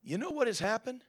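ordinary delivery

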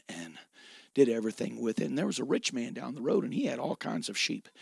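ordinary talk, nothing marked

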